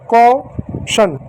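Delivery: "क्वेश्चन" split into "kau" and "shan" with a gap; syllable by syllable. Shown as a demonstration. Teacher showing pupils how to read it, "क्वेश्चन" broken as kau-shan. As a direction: say "क्वेश्चन" slowly in two parts, kau... shan. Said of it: "इसको कौ".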